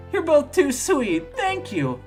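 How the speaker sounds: high, sweet gushing thanks; touched, pitched well up; gushing birthday girl. Acted. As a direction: gush it in a high girlish voice. Voices Amy thanking for presents.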